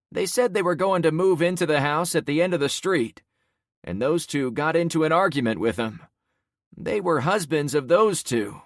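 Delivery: bystander recounting. Acted. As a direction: tell it as a shaken neighbor. Man recounts the killings.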